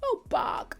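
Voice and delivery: high, small, breathless pining voice; mock a lovesick girl, softly and high; Nessa Rose. Lovesick girl sighing to her crush, mocking.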